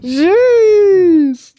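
high, loud startled cry of "Jeez!"; shocked yelp. Real, not acted. Shocked reaction to a meme clip.

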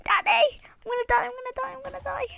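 high cries fading to a fast whisper; panicked squeal to whisper. Real, not acted. Child to dad, about to die in game, panic fading to a whisper. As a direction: panic then whisper in terror.

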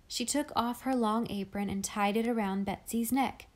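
ordinary delivery

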